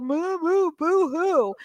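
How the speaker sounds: high mock sobbing boo hoo; hammed-up fake blubbering; fake crying. Mocking own old-age whining.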